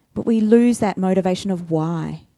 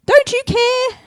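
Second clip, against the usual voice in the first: much higher, pleading don't you care; high, indignant complaint; whiny demand; 4 dB louder than usual. Acted. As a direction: squeal it like a hurt sister. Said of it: "She's direct".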